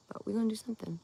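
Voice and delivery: hushed whisper. Real, not acted. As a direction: whisper it like a secret. Whispered aside to viewers.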